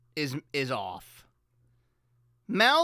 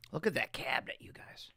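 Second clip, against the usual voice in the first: whisper; 6 semitones lower, 11 dB quieter than usual. Real, not acted. Showing old studio photo.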